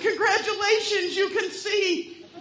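high, loud and drawn out with a laugh; spring the punchline with glee; triumphant gotcha. Acted.

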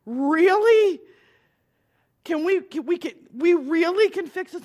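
high, loud, eager rush of hope; excited disbelief. Retold or imitated. Boy asking minister if he can really be fixed, with excited high-pitched disbelief. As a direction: burst out as if you can't believe it.

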